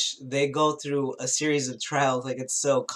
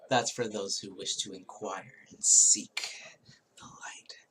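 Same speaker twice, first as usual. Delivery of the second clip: a mysterious, secretive whisper; hushed whisper; 4 semitones lower than usual. Acted. Vlogger whispers a secret to seekers of the light, hushed. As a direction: breathe it out as a secret, unvoiced. Mystic aside on seeking light.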